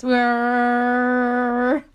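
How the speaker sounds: drawn-out mechanical whir; whirring noise. Retold or imitated. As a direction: hum like a laptop fan. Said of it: "Does it make that noise?".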